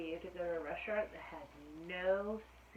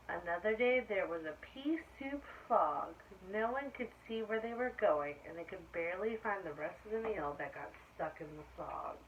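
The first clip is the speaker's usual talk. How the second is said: put-on story reading to children; 2 semitones lower, 4 dB louder than usual. A narrator's reading. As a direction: draw out the bad weather for the kids. Reading picture book aloud.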